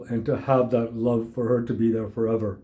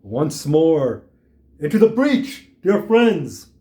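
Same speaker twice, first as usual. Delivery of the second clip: higher, louder theatrical declaiming; mock Shakespeare; 4 semitones higher, 5 dB louder, slower than usual. Older man throwing Shakespeare back at the kid, mock declamation. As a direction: ring it out like a rallying battle quote. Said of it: "probably throw Shakespeare at me".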